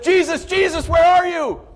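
high, loud calling shout. Acted. Thomas calling through the house for Jesus, loud shout.

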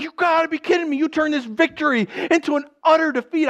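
high, exasperated acted objection; incredulous protest. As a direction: object in hammed-up disbelief. Voicing Joab's disbelief.

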